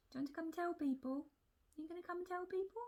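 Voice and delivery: high, quick sing-song coaxing of a cat; pet-directed coaxing. Real, not acted. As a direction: coax a pet in a high little voice. Coaxing her cat to come over.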